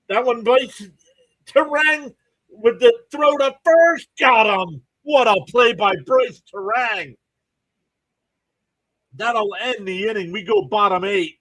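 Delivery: high, loud call of a great play; thrilled announcer, pitched well up and punched out; excited play-by-play. Real, not acted. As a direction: erupt into a big baseball call, stretching words.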